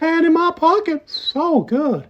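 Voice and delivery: high, screeching pervert voice, far above own pitch; screechy high-pitched creep voice; Herbert the Pervert. Shown as a demonstration. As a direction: squeal it out like a creepy old man. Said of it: "different than the Herbert the Pervert".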